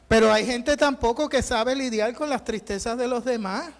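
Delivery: ordinary talk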